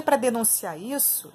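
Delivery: ordinary delivery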